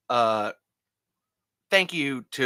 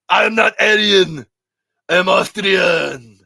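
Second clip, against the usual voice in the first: much louder, higher Arnold bark; Schwarzenegger impression; 7 semitones higher, 13 dB louder than usual. Schwarzenegger scolding Stallone on the phone, loud Arnold voice. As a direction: shout at him like Arnold Schwarzenegger. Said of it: "and Schwarzenegger".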